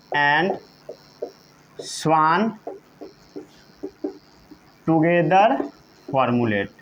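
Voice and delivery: word by word with long pauses; measured, spaced-out dictation; slow dictation. Shown as a demonstration. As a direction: dictate slowly for note-takers. Teacher dictates for note-taking.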